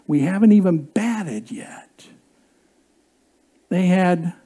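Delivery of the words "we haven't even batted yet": soft unvoiced whisper; whispered kids' reply. T-ball kids say they still have a chance, whispering. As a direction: breathe it out like a secret.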